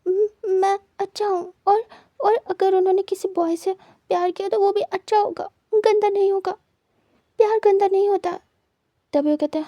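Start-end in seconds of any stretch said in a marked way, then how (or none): 0.1-8.4 sobbing, pitched higher, stammering; calm yourself mid-sob and insist; steadying a breaking voice through tears; crying. A narrator's reading. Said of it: "रोते हुए खुद को शांत करता है".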